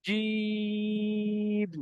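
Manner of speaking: জিম pressed, thick and held very long; long jim. Shown as a demonstration. Teacher demonstrating pressed, long jim to class. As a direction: stretch জিম slowly, pressing the j. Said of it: "জিমের আওয়াজটা চেপে হয়".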